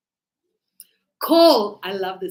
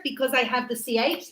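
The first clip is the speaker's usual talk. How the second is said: usual speaking voice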